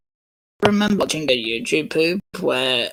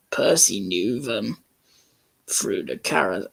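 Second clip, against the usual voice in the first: ordinary delivery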